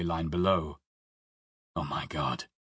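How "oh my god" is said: shocked whisper. Acted. Sees car on railway.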